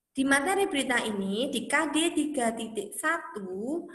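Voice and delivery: ordinary talk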